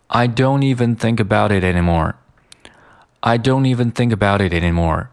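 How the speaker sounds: "I don't even think about it anymore" run together; fully linked speech. Shown as a demonstration. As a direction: link every word, flap the t in "about it anymore". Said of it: "把这些所有的连读，啊，全部连在一起". (全部连读)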